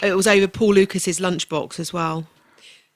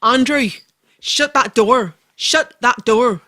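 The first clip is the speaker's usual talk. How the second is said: louder, higher Belfast teacher telling off; Belfast accent; 4 semitones higher, 5 dB louder, slower than usual. Acted. Geography teacher orders Andrew to shut the door, Belfast accent. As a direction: scold a pupil in a Belfast accent. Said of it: "I can do Belfast accent".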